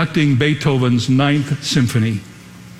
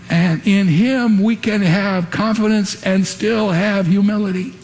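ordinary delivery

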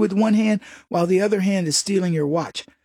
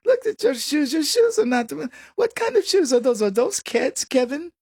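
mock snob ridicule; 6 semitones higher than usual. Imitating Anna Delvey mocking people's clothes, high snobbish. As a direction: look down your nose, pitched way up. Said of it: "she would ridicule people".

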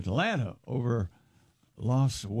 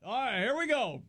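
higher, rallying the dugout with mock excitement; hammed-up team pep talk; pumped-up ballplayer; 8 semitones higher, 3 dB quieter than usual. Mariners player on the bench rallying teammates, mock hyped-up. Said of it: "if you're a Mariner and you're on the bench and you start talking".

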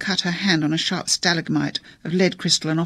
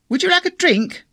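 much higher and louder, covering a noise; unnecessarily loud; 14 semitones higher, 4 dB louder than usual. A narrator's reading. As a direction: blurt it too loud to drown out a row. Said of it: "in a quite unnecessarily loud voice".

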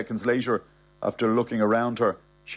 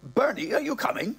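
put-on high, protesting old-man voice; higher-pitched, put-upon old fella; flustered old man; 6 semitones higher than usual. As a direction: concede while pleading innocence. Old man conceding, calling Bernie.

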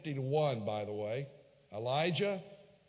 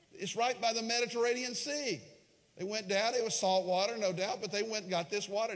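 ordinary talk, nothing marked

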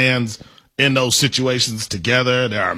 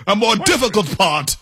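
higher, mocking British accent; 5 semitones higher than usual. Co-host saying don't say it so, mock British accent. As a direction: quote it in put-on British. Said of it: "That's such a British thing".

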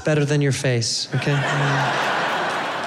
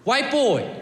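imitating a young kid calling out, higher; 9 semitones higher, slower than usual. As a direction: call out like a cocky little kid. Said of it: "comes up to me and goes".

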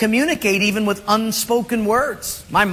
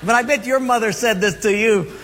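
normal talking voice

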